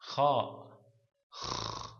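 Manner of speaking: "Kha", then the "Kh" alone as a long voiceless throat rasp; Arabic kh sound. Shown as a demonstration. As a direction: demonstrate Arabic "Kh" as a raspy fricative. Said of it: "There is also no English".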